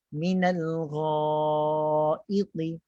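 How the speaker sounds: long, held GHA with the lips left neutral; relaxed lips. Shown as a demonstration. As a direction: raise only the tongue root for gha, lips unrounded. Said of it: "Jadi kita pastikan". (gha panjang, bibir kekal biasa, pangkal lidah tinggi)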